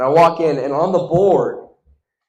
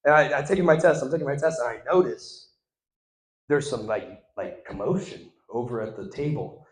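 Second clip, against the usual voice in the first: ordinary delivery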